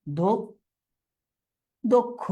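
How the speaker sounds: "দক্ষ" broken after the first syllable, said "dok... kho"; syllable-by-syllable pronunciation. Shown as a demonstration. Teacher showing students how দক্ষ sounds, syllable by syllable. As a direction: split "দক্ষ" with a long pause, rounded o. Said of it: "এটার উচ্চারণটা কি হবে".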